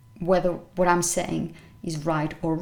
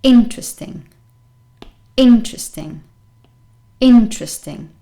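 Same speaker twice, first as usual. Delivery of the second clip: interesting, first syllable punched loud; IN-tresting, strong start and weak middle; stress on first syllable; 2 semitones higher, 8 dB louder than usual. Shown as a demonstration. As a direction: hit the IN of interesting hard. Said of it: "is on the first syllable".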